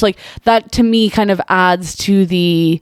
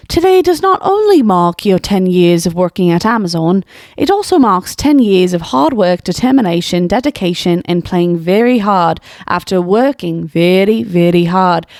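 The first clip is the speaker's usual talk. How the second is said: put-on runaway mix of British and Australian accents. Commentator mocking a fourth grader's letter in a British-Australian accent. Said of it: "that accent got away from me".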